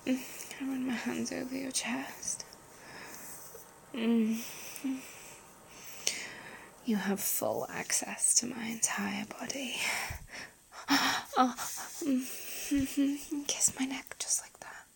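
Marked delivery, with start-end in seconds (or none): 0.6-2.4 soft intimate whisper; breathy close-mic whisper. Acted. Whispered intimate roleplay throughout. 6.8-9.8 soft intimate whisper; breathy close-mic whisper. Acted. Whispered intimate roleplay throughout. 12.7-14.9 soft intimate whisper; breathy close-mic whisper. Acted. Whispered intimate roleplay throughout.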